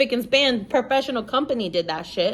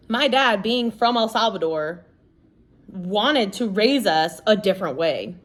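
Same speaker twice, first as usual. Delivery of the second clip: ordinary talk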